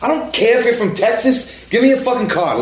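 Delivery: high mock yell of an angry attendant; furious yell. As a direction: blast it like an outraged Jersey pump attendant. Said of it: "And he was like".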